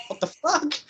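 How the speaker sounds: high-pitched mock disbelief; retold shock. Reacting to the rabbit demonstration, retold shock. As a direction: go high on an incredulous question.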